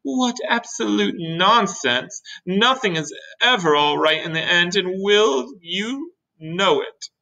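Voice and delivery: high, drawn-out scornful earthworm; scornful gloomy earthworm. Acted. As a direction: dismiss all hope, stretching the words.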